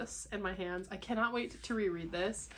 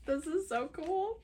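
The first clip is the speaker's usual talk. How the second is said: high, rising thrilled voice; thrilled squeak; 9 semitones higher than usual. Real, not acted. Friend admiring a handmade book, thrilled squeak. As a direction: squeak with real delight, rising at the end.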